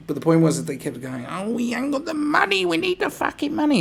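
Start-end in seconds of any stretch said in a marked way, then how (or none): 1.3-3.8 very high, loud hammed-up whine; mock wailing. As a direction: imitate desperate begging at the top of the voice. Mocks police begging for money.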